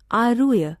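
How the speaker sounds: ordinary delivery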